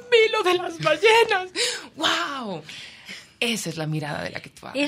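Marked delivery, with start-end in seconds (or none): 0.1-2.6 high, loud, near tears; joyful. Retold or imitated. Strangers telling her of the whales, high tearful joy. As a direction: say it overflowing with joy. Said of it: "sino que me decían".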